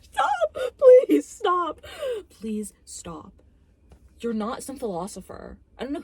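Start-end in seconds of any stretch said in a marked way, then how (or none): 0.0-1.8 very high, loud screamed plea; exasperated pleading shout. Real, not acted. Reviewer begging the movie to stop, exasperated scream. 2.3-3.3 softer plea after an outburst; subdued weary plea. Real, not acted. Reviewer begging the movie to stop, subdued plea.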